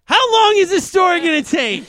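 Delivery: high, loud impatient complaint; impatient outburst. Real, not acted. Cohost impatient with a long story, loud and high.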